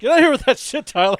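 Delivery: high, loud playful yell; mock outraged yell. Real, not acted. Player yelling at the GM, loud mock outrage. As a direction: shout him down jokingly.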